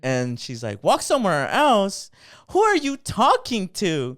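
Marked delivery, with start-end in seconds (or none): 0.8-2.1 slow, higher, louder incredulous repeat; mock outraged echo. Lady throws the retort back, outraged. 2.5-4.2 slow, high, loud imitation of indignation; mock outrage.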